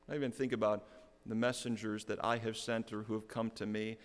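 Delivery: ordinary talk, nothing marked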